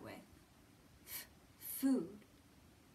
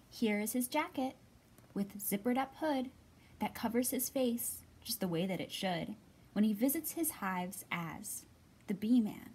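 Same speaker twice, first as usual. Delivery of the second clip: louder, rhythmic storybook read-aloud; reading to children; 6 dB louder than usual. Acted. Teacher reading a picture book to children, lilting read-aloud. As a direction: read the rhyming book to little ones.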